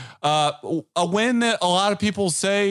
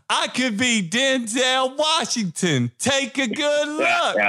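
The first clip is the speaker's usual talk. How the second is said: slow, higher mock shout; Martin Lawrence as Denzel; 7 semitones higher, slower than usual. Martin Lawrence's movie character yelling to be looked at, slow and high. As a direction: stretch it out, shouting up high in a hammed-up impression. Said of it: "he goes".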